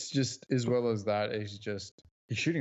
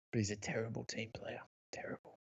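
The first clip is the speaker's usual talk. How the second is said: hushed, breathy aside; whisper; 8 dB quieter than usual. Real, not acted. Co-host whispering a harsh verdict on Luka, hushed.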